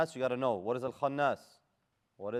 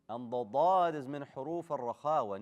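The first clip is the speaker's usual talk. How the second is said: ordinary talk, nothing marked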